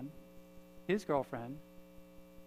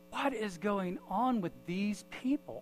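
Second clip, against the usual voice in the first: slow, higher-pitched puzzlement; bewildered; 5 semitones higher, slower than usual. Retold or imitated. Man thinking to himself as he leaves, baffled. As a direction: stretch it out in retold bafflement.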